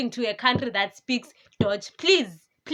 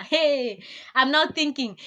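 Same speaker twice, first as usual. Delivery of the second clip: normal talking voice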